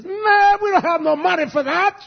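high, loud protest; exasperated refusal. Retold or imitated. Pastor retells telling a brother there is no money, loud and exasperated. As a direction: throw up your hands at the cost.

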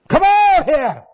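loud, high rallying call; roaring call to the congregation; preacher's shout. Real, not acted. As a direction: shout it to the whole church.

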